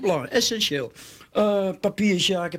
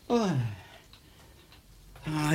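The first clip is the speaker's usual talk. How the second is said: normal talking voice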